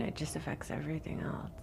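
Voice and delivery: whisper. Real, not acted. Trailing whisper.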